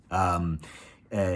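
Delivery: ordinary talk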